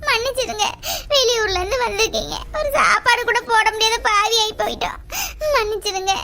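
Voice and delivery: loud, high lament that sinks into sobs; tearful wailing. Acted. Host apologising to guests who leave unfed, wailing. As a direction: cry out in anguish, then apologise through tears.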